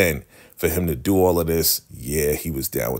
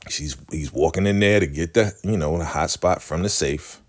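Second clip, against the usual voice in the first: ordinary talk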